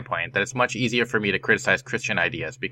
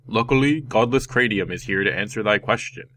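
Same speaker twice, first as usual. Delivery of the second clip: mock heroic announcer voice; hammed-up superhero voice. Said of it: "That was my best superhero voice".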